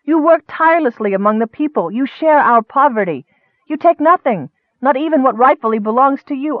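put-on high, impassioned young woman defending him; passionate outcry. A narrator's reading. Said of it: "Nicole cried".

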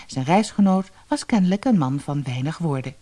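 usual speaking voice

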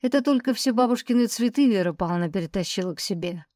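plain reading voice

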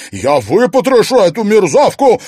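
high, loud furious yell; enraged threat; furious shout. A narrator's reading. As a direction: shout while held back. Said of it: "кричала Марьяна".

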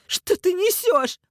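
very high, loud, slow shriek; outraged. A narrator's reading. Mother denies ordering the kidnapping, outraged. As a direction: cry out in furious disbelief.

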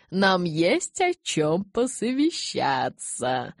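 slow, drawn-out ceremonial voice; solemn address. A narrator's reading. Elder summoning fellow elders to confer, slow and solemn. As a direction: pronounce it solemnly, very slowly. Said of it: "торжественно произнёс он".

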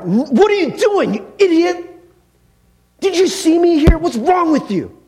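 high, loud furious yell at a bad driver; angry yell. Preacher reenacting his road rage at another driver, a loud furious yell. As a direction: bellow it at the idiot who cut you off. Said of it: "You know, I yell".

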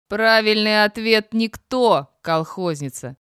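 loud, slow, malicious announcement, dropping at the end; gleefully cruel jeer; gloating. A narrator's reading. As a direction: crow it spitefully at her. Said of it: "злорадно заржал Эдик".

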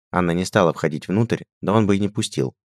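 plain reading voice

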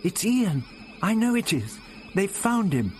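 high-pitched despairing cry. A narrator's reading. Vicky grabbing the doctor's arm, despairing. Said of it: "Vicky caught at his arm despairingly".

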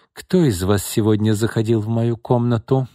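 usual speaking voice

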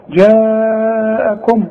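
جاءكم with the long aa held out very long on one level note; madd held four counts. Shown as a demonstration. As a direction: stretch the first vowel of جاءكم for four counts before the hamza. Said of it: "دي أمدها عند الوقف وعند الوصل أربع حركات". (مدّ «جاءكم» أربع حركات)

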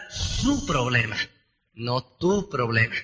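slow, low stress on "su problema", then "no tu problema". Shown as a demonstration. Coach to sales recruits, slowly stressing whose problem it is. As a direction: lean on "su" and "tu", drawn out.

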